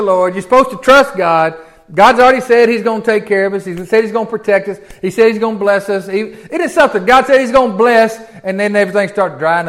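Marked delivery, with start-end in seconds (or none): none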